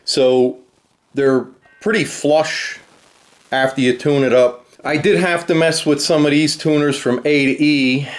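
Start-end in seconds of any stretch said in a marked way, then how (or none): none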